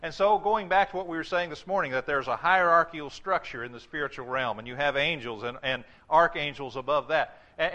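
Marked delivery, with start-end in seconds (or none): none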